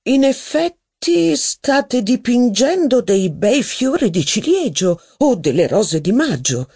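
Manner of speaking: lightly voiced hesitant scholar; hesitant historian. A narrator's reading. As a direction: offer the flower's name unsure. The historian ventures a guess.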